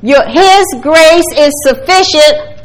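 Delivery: preacher proclaiming to the congregation, shouting; preaching shout. Real, not acted. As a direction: call it out to the whole room.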